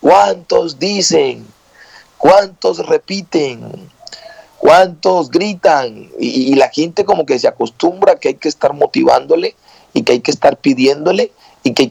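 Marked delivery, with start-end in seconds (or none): 0.0-6.1 slow, drawn-out call to the congregation; pulpit motivator. Retold or imitated. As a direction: imitate a preacher prompting the crowd to respond. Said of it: "uno esté motivando desde el púlpito".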